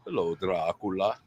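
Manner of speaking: low, drawn-out vampire hello; deep, slow mock-vampire welcome; mock Dracula greeting. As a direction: greet him like a hammy Count Dracula. Greeting a guest called Dracula.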